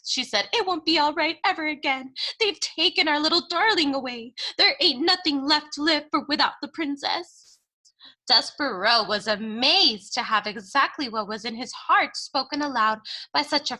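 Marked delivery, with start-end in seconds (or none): 0.5-7.6 higher, tearful lament; grieving Cook. Acted. Cook lamenting to Louise that the princess is gone, tearful. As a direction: mourn the stolen princess.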